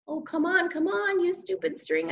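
high, strained grumble, not hushed; frustrated muttering. A narrator's reading. Boy struggling with slippery string, frustrated muttering. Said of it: "I muttered under my breath".

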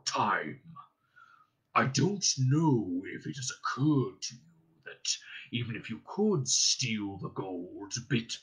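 put-on a sly old dragon toying with a thief; menacing drawn-out dragon taunt; Smaug the dragon. Narrator voices the dragon Smaug.

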